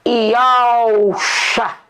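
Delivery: Yalcha said slowly, ee-ow-sha, set apart; demonstrating the Hebrew pronunciation; Hebrew name shown. Shown as a demonstration. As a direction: present Yalcha as a careful model word. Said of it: "Por exemplo".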